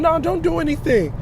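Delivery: hammed-up panicky woman's voice; mimicking the woman. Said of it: "he listening to his woman".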